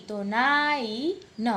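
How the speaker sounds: નાઈન said very slowly; slow teaching demo. Shown as a demonstration. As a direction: stretch નાઈન. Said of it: "ઈ નો ઉચ્ચાર કરી અને ન".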